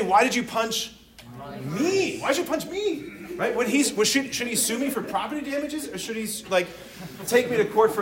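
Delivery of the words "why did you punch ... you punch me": me? pushed high, then Why'd you punch me? with me rising higher; stress on me. Retold or imitated. Josh, punched in the face, stressing me much higher. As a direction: lean hard on the word me. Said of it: "He would say".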